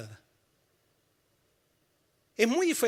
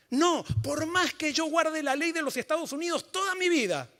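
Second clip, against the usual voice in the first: rising, louder, higher preaching; preaching at full force; 4 semitones higher, 6 dB louder than usual. Acted. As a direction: build the sermon to a passionate climax. Preaching climax.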